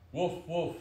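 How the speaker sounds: high, loud double woof; puppy bark. A narrator's reading. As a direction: bark like a little storybook puppy. Puppy barks at firefly.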